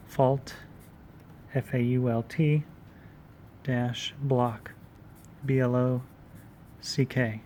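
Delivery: fault block spelled letter by letter; spelling it out. Shown as a demonstration. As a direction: dictate each letter slowly. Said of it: "spelling out for notes".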